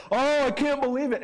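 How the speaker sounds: high, mock grieving cry; a hint of staged grief; mock lament. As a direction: wail it like a hammed-up mourner. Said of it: "a thing in the Bible times to just say".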